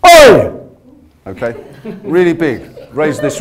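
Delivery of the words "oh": huge, high full-voiced "Oh!" shout; almighty shout. Shown as a demonstration. Coach showing the class a huge shout. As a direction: raise the roof with one big "Oh!". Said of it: "you're gonna give me the most almighty".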